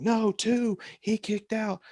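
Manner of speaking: a high, urgent call of no, only two; excited callout. Retold or imitated. Referee calling off the three count, high and urgent. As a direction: wave the crowd off the pin, keyed up. Referee signals kickout to crowd.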